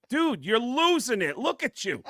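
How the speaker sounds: high, loud laughing yell at a relative; mocking rant. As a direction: shout it while laughing, teasing and merciless. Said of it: "you're not supposed to go like".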